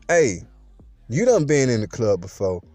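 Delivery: plain reading voice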